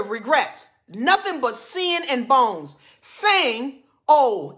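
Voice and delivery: loud, high preacher reading scripture; fired-up preaching. Acted. Preacher reads warning verses to the congregation, fired-up and loud. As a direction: drive each verse home, drawing out key words.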